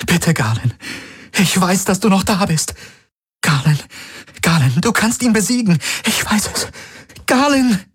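high tearful begging, rising to a crying call; pleading. A narrator's reading. Kirai pleads with possessed Garlin, high tearful begging. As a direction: beg him to fight back. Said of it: "Sie sah ihn flehend an".

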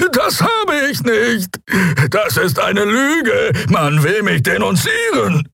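high, drawn-out alien protest; frantic Draxyl. Acted. Prisoner denying he destroyed the cube, frantic. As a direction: cry out against the lie, high.

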